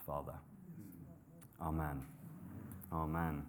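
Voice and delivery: soft, fading prayer voice. Acted. Pastor praying for the church before the sermon, hushed. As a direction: pray gently, letting it drop low.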